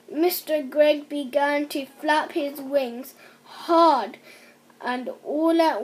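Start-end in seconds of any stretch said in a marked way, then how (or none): none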